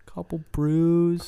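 slow, drawn-out bro voice; lazy frat guy drawl; dudebro voice. Pretend. Joking about bro hangouts.

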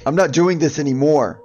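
husband fed up at the crib; angry. Acted. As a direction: bite it off in anger. Husband snapping during the quarrel.

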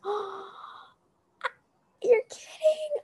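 high re-enacted gasp of surprise; delighted disbelief. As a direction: relive the shock, voice leaping up high. Re-enacts her delighted reaction.

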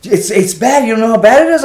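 retold upset owner, high and loud; upset scolding. Hotel owner telling off the guest over the smoke, upset.

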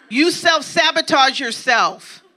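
ordinary talk, nothing marked

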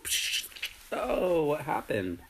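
usual speaking voice

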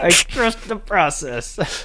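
high, drawn-out mock slogan; slow, high-pitched and playful; drawn-out, joking. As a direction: stretch every word up high. Opening line performed oddly, joking.

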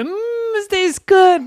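put-on high, relishing kid voice; delighted tasting. A narrator's reading. Boys with syrup on their tongues, delighted.

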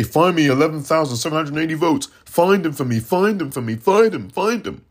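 fast, insistent put-on voice; mock him begging for votes; imitating a pushy demand. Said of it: "and say".

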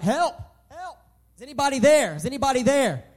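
a mild, lightly acted cry for help; calling out for help. A narrator's reading. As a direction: call out for help while hanging from a cliff. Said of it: "he just started yelling out".

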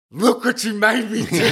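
high-pitched accusing yell through laughter; hammed-up wail of blame, half laughing; mock outraged cry. Acted catchphrase shouted at Rod.

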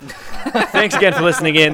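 loud, slow, laughing thanks; laughing sign-off. Real, not acted. Host closing the podcast after friends bicker, laughing loudly.

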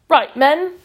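a sharp, loud army corporal call; brisk corporal. A narrator's reading. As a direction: snap it out like a drill corporal. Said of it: "Fickett sparked".

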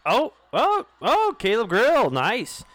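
rising excited cheer; real thrill at the play. Real, not acted. Reacting live to a good play.

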